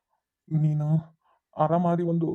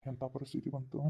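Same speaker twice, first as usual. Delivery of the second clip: quiet lament; 3 semitones lower, 14 dB quieter than usual. Acted. Father confessing a hidden truth.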